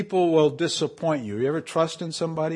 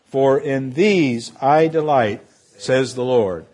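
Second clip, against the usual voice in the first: slow, louder solemn reading; scripture reading; 5 dB louder than usual. Acted. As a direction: proclaim the verses with weight. Reads scripture aloud.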